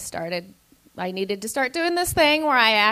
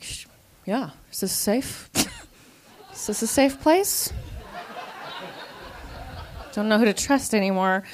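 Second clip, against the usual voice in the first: paranoid whisper; 5 dB quieter than usual. Retold or imitated.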